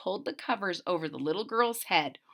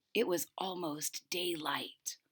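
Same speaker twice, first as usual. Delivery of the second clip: hushed, breathy whisper; suspense whisper; 3 semitones lower, 12 dB quieter than usual. A narrator's reading. The storyteller hushes the children about daylight, whispering. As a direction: whisper it like a secret.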